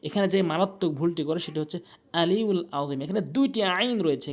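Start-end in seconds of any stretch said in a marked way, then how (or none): 2.2-3.0 আলিউল আযীম with plain light vowels; hamza for ayn. Shown as a demonstration. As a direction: say both openings of আলিউল আযীম without throat squeeze. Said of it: "হামজা এনে উচ্চারণ করে".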